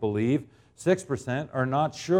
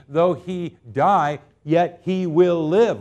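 slow emphatic verse; 3 semitones higher, 4 dB louder, slower than usual. Real, not acted. Slow emphatic scripture climax.

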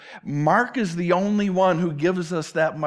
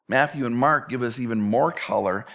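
ordinary talk

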